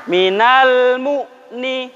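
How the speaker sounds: drawn-out, very slow chanted recitation; slow tajwid recitation. Shown as a demonstration. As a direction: stretch each syllable like Quran reading for pupils to copy. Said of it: "Ayo untuk muslimin ikutin".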